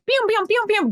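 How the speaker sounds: high "bam" pings in quick succession; springy, repeated notification sound; rapid alert noises. Retold or imitated. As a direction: imitate phones blowing up with messages. Imitates text alerts firing.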